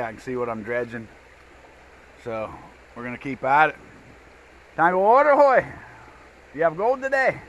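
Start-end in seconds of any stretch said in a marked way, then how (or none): 4.8-7.4 high, loud call across the river. Real, not acted. Calling to the worker across the dredge, high and loud. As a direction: shout over to the worker about his gold.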